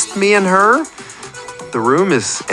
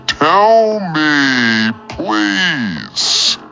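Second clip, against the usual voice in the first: high drawn-out plea, falling on please; beg, stretching out each word; pleading; 5 semitones higher, much slower than usual. Acted. Caller begging DJ for an answer, pleading.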